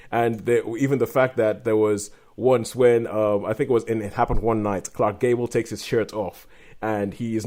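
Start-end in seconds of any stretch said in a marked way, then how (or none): none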